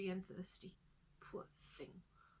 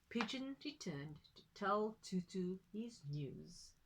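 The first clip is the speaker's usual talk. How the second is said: usual speaking voice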